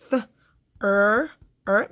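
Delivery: "Th" alone, then loud held "er, er"; Th unvoiced, er as a long R-colored vowel; isolated sounds. Shown as a demonstration. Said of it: "this is an R control vowel".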